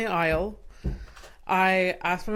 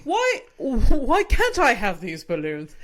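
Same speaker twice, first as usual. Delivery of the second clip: put-on high, louder British accent whine; British child accent; 8 semitones higher, 5 dB louder than usual. Retelling asking mum for balloons, high British accent whine. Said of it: "I had a British accent".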